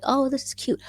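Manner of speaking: high-pitched put-on cute reaction; mock cooing. Farmer imitating her upbeat video for viewers, mock cooing. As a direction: coo it high, imitating an easy delight.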